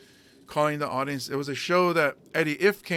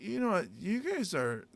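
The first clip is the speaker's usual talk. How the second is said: higher-pitched taunt, slowed on the insult; mock crowd insult; 4 semitones higher, 5 dB quieter than usual. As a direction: needle a noisy bar crowd, hammed up. Insulting the crowd to grab attention.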